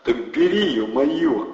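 high, loud gangster urging; pushy thug. A narrator's reading. Thug urges Andrey, loud and pushy. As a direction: push him to take it.

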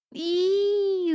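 high, drawn-out "eee" squeal; narrated squeal. A narrator's reading. Rilan squeals under the apple tree, high squeal. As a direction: squeal a long high vowel in mock fright. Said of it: "завизжал под яблоней Рилан".

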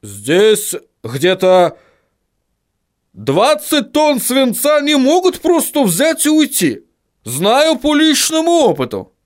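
high, slow, emphatic dwarf voice; high-pitched dwarf protesting; indignant dwarf. Acted. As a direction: insist on it in a high, strained voice.

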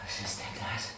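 unvoiced, uneasy question; whispered alarm. Hero whispers in alarm at the strange powder in the barrel. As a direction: breathe it out in sudden suspicion. Startled find in the dark.

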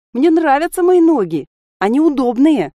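high, loud, upset wail; tearful protest. A narrator's reading. Alisa defends her legs as comfortable, wailing tearfully. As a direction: protest loudly, as if close to tears. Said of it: "всхлипнула Алиса".

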